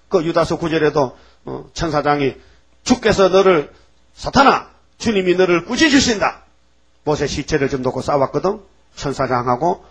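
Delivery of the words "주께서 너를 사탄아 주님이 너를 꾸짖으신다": higher, louder stern declaration; stern rebuke. Acted. Archangel rebuking Satan over Moses' body, stern loud. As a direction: rebuke the devil in the Lord's name.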